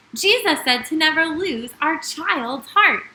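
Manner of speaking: normal talking voice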